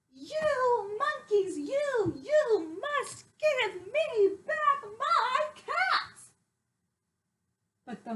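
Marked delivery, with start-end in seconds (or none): 0.1-6.3 high, slow, louder angry shout at the monkeys; very angry peddler. Acted. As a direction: demand the caps back, fuming. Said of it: "he shouted".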